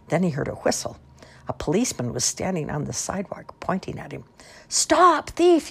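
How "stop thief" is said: high, loud commanding yell. A narrator's reading. Policeman pointing at Jerome on the roof, yelling. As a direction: yell to halt a culprit. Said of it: "yelled the policeman".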